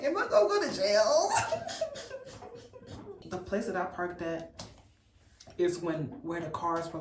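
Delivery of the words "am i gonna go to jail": high, laughing mock worry; laughing panic. Vlogger joking about her first ticket, laughing high. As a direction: ask it half laughing at yourself. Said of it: "And I'm like".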